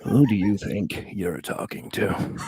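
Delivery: slow, low villain voice, drawn out; Joker. Shown as a demonstration. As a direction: deliver it as a slow, menacing comic-book villain. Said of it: "using the Joker voice".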